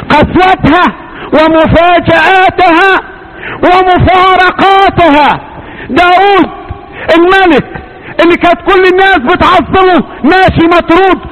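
high, slow, stretched preacher's cry; full-force preaching. Acted. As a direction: drag each word out high and ringing. Preaching on life's cruelty.

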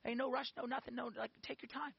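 soft, gentle reassurance; calm him down softly; reassuring. Retold or imitated. Girlfriend soothing him, soft and gentle. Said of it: "She's like".

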